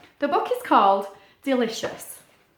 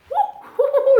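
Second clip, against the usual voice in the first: squirrel squeal; 14 semitones higher, 8 dB louder than usual. Pretend. Puppet squirrel being tickled.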